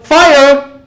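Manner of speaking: yelling fire into a crowded room; imitated yell. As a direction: call it out like someone raising an alarm. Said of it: "comes in here and yells".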